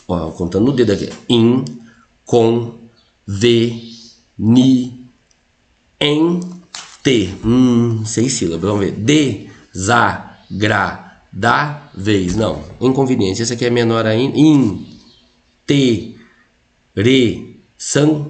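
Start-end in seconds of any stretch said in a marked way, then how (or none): 1.3-7.3 syllable by syllable. Shown as a demonstration. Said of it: "Vamos lá pra separação de sílabas". 9.7-12.4 very slow "Desagradáveis", a pause between syllables. Shown as a demonstration. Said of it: "Vamos lá pra separação de sílabas". 14.4-18.2 isolated syllables with long pauses. Shown as a demonstration. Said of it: "Vamos lá pra separação de sílabas".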